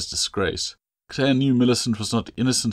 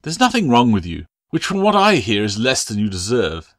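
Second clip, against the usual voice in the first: much louder, cutting rebuke; harsh scolding; 3 semitones higher, 8 dB louder than usual. Acted. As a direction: berate her coldly and loudly. Mistress Latch berates Claire.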